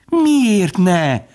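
high, drawn-out delight; with relish. A narrator's reading. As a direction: savour it in a high woodpecker voice. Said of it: "mondta a harkály élvezettel". (élvezettel, magas hangon)

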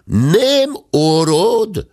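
very slow, loud, high shout; shouted into the ear. A narrator's reading. Servant bellowing into the deaf guest's ear, slow shout. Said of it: "a bátya fülébe ordította".

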